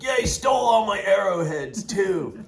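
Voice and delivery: high, loud aggrieved shout; indignant complaint. Retold or imitated. Host telling hikers his arrowheads were stolen, loud and aggrieved. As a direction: hostel owner crying out over stolen arrowheads. Hostel guy complaining loudly.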